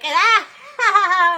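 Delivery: loud, high cackling fake laugh; mocking laugh. Speaker imitates the Dodgers laughing at Catholics, loud mock cackle. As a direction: laugh like you're sneering at them. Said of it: "and then you're like".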